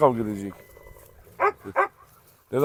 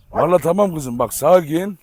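usual speaking voice

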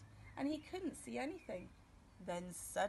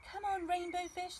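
high, louder playful call; other fish calling; 8 semitones higher, 4 dB louder than usual. Acted. Other fish inviting rainbow fish to play, high call. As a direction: call him over to play. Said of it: "they called".

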